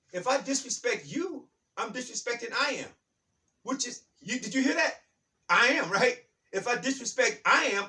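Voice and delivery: loud, high, driving preacher's cadence, pounding the key words; full-force preaching. Acted. Preacher rousing his audience about I am, in a loud, high preaching voice. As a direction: preach it out to the crowd, rising and insistent.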